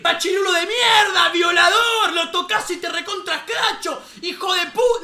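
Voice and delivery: high, loud mock outrage; angry yelling. Girl yelling through the fence, high mock-furious shout. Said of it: "empezaron a gritarle".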